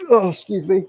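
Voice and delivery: stifling a sneeze mid-sentence; holding back a sneeze. Real, not acted. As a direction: talk while fighting off a sneeze. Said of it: "I'm trying not to sneeze".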